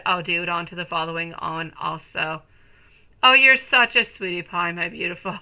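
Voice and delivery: ordinary talk, nothing marked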